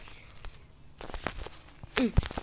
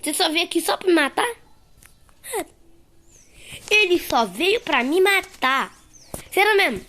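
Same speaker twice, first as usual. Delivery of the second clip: higher, louder indignant outcry; outraged; 7 semitones higher, 6 dB louder than usual. Real, not acted. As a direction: shout it in disbelief at the killer. Outraged at being killed. (indignado, gritando mais agudo)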